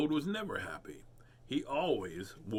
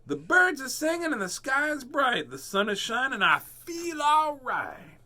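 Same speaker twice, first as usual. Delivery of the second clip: high, loud squeaky squirrel, slowing at the end; high excited critter voice; happy squirrel; 11 semitones higher, 5 dB louder than usual. Acted.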